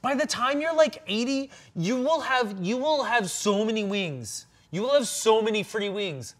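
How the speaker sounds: high, fast and giddy; excited squirrel voice. Acted. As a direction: squeal about the free wings. Squirrel hypes the free wings.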